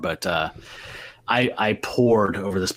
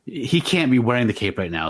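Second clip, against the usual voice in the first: usual speaking voice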